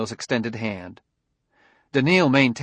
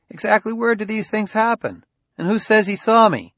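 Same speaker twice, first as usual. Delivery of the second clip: higher-pitched, indignant questioning; protesting suspect, voice raised in pitch; defensive challenge; 10 semitones higher, 3 dB louder than usual. Acted. Suspect challenges the detective's accusation.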